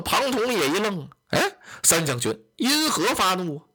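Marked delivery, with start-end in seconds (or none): none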